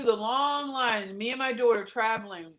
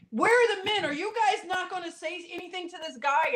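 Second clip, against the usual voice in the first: indignant challenge; 7 semitones higher than usual. Retold or imitated. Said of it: "I said".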